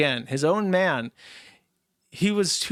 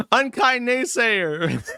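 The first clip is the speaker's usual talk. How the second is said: giggly; 7 semitones higher, 3 dB louder, much slower than usual. Real, not acted. Giggly reading of donor name.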